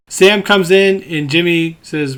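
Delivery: ordinary talk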